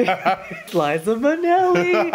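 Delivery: high, slow puppet voice; sock puppet voice. Retold or imitated. Playing with sock puppets for Alexis, high slow voice. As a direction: draw it out like a puppet show.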